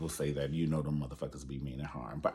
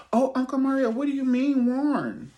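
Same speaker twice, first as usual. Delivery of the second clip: mock eager fan; 9 dB louder than usual. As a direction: squeal the question like an overeager kid. Mock eager audience question.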